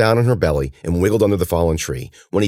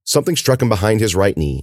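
plain reading voice

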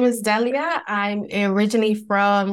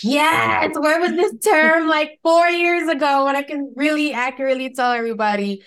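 much higher, eager retold outburst; excited realisation; 6 semitones higher than usual. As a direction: exclaim it as a sudden relief.

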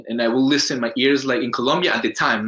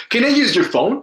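high and loud, a sweetly polite ask in Colombian English; polite high request; 10 semitones higher, 6 dB louder than usual. Retold or imitated. Immigrant asking a store clerk for the phone, high polite ask. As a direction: call out the question high and bright.

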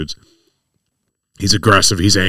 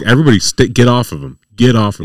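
raised, urgent mock order; retold command; 5 semitones higher, 6 dB louder than usual. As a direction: call them off firmly, a bit louder and higher.

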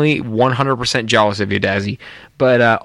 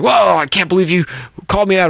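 higher-pitched, indignant retelling; mock offended; 6 semitones higher than usual. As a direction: act out being offended, voice raised in pitch. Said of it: "he got all offended".

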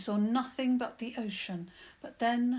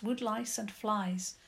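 ordinary delivery

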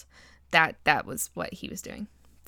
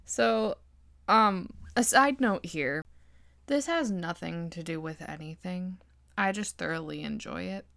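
ordinary talk

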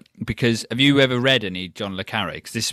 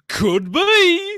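silly high voice; 5 dB louder than usual. Pretend. Mock quiz-show answer voice.

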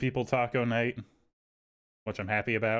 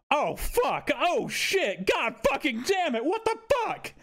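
imitating grandma's much higher cursing outburst. Grandmother over the knocked plant, high mock cursing. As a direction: squeal the curses up high like an outraged grandmother. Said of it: "from the kitchen, I hear".

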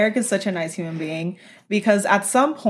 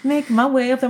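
ordinary talk, nothing marked

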